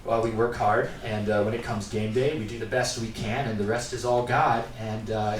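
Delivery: deadpan athlete interview. Retold or imitated. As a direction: flatly blame God for the loss. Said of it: "they're just like".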